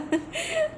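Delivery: sudden high laugh; high burst of laughter. Real, not acted. Retelling husband's Punjabi joke, bursting into laughter. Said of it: "तो इतनी हंसी आती है".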